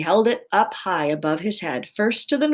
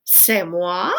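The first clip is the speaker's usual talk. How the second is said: offended disbelief; 4 dB louder than usual. A narrator's reading. Offended wife answers in French.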